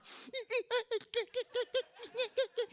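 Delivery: very high squawking cry-laugh; chicken cry. Shown as a demonstration. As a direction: let the chicken cry roar out, high and strained. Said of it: "the chickens starting to roar".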